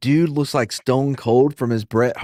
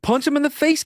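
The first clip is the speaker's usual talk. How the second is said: retold yell; 13 semitones higher than usual. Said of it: "I was like".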